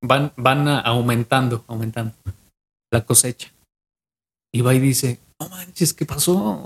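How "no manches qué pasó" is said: high, hushed gasp of wonder; half-whispered astonishment at the sudden harvest; amazed disbelief. Retold or imitated. Farmer marvels quietly at his crops springing up, high and hushed.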